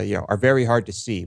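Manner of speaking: ordinary talk, nothing marked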